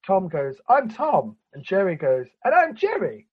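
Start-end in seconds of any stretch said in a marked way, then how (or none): 0.7-1.4 high-pitched mock cartoon hello; cartoon introduction. Imitating Tom introducing himself on screen, mock cartoon voice. 2.4-3.3 very high mock cartoon voice; cartoon introduction. Imitating Jerry chiming in after Tom, high mock cartoon voice.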